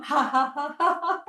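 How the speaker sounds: high laugh trailing off; squeaky-high forced giggle; motorbike laugh. Shown as a demonstration. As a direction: start the engine with a laugh. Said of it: "That's the motorbike laugh".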